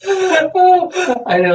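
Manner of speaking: high burst of real laughter; laughing out loud. Real, not acted. Laughing at her own question.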